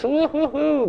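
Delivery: high sing-song woo-hoo-hoo, hammed up; mock gushing whoop. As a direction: whoop it up like an overeager greeter. Mocking Messianic friendliness.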